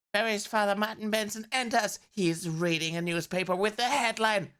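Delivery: ordinary delivery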